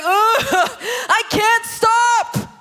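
high, drawn-out wailing sob; hammed-up uncontrollable weeping; mock ugly crying. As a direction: wail it as if the tears won't stop.

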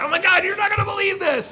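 high, loud excited shriek; excited shout. Retold or imitated. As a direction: squeal the news out. Excited retelling to friend.

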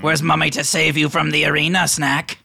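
high, mocking creature voice; taunting kobold. GM as Grey Gut taunting Snack, high and mocking.

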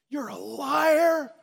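high, loud, slow furious shout of an accusing father; a father exploding at his daughter in the hallway, slow loud yell; angry yell. Retold or imitated. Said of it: "I kinda blew up".

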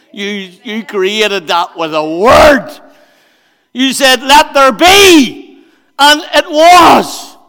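slow, loud, soaring praise; awed proclamation. Real, not acted. Preacher addressing God under the stars, slow and loud. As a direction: proclaim it with rising awe.

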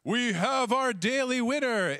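mock emcee announcing over a PA; microphone announcer. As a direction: boom it out to the crowd. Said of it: "I hear this microphone going".

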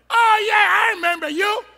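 loud gotcha cry of recognition; calling out. Acted. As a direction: point him out across the yard. Bystander calls out recognizing Peter.

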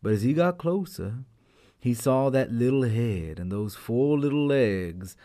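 ordinary delivery

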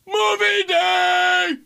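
high, slow squealed "Movie day!"; stretched-out gleeful cheer; excited shout. Retold or imitated. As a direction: belt it out like a giddy kid.

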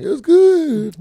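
high, loud, drawn-out mimicry; mock him in a stretched falsetto; mocking womanish voice. Host mimics co-host's answer about his week, mocking womanish voice. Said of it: "You sound like a woman".

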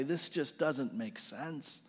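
soft and slow, nearly under the breath; quiet despair. Retold or imitated. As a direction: murmur it to yourself, worn out. Opening, quiet echo of cry.

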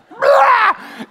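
high, explosive losing-it roar; mock scream. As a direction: let out a wild yell as if snapping. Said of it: "I just screamed".

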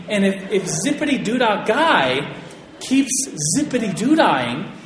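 usual speaking voice

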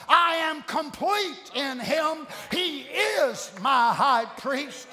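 slow, rhythmic shouted litany; fervent preaching. Real, not acted. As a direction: build a rolling chain of declarations, stretching each opening. Preaches declarations of faith.

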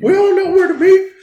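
high, laughing call to scatter; rallying shout. Acted. Calling out to the crew to flee.

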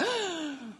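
sharp mock gasp of second thoughts; acted intake of breath; nervous gasp. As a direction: gasp as if suddenly realizing. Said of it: "they got nervous".

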